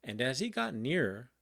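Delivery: ordinary delivery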